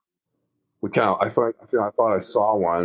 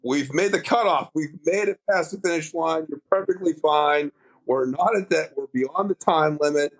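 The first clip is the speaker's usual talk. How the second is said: higher, gentle reassurance to a scared friend; reassuring buddy; 7 semitones higher than usual. Retold or imitated. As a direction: soothe him, tell him he's safe. Reassuring panicked dive partner.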